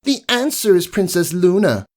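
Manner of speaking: high, smug know-it-all answer; smug pupil. Acted. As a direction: show off the right answer. Smug teacher's pet answers.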